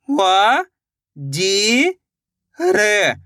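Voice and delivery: व, जी, र, each held long and separate; syllable-by-syllable sounding out. Shown as a demonstration. Teacher breaks a word down for learners, व, जी, र one by one. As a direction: draw out व, जी and र with gaps between. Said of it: "एक एक अक्षर का उच्चारण करके".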